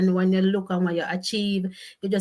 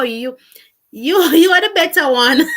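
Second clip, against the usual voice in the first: much louder, higher words through laughter; loud laughing tease; 6 semitones higher, 11 dB louder than usual. Real, not acted. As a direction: laugh it out at the viewer. Laughing at viewer's reply.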